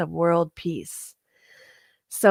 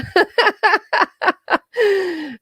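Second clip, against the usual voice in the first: a high, loud laugh at her own joke; high laughter; 13 semitones higher, 4 dB louder than usual. Real, not acted. Speaker laughing about her wish list, high laugh.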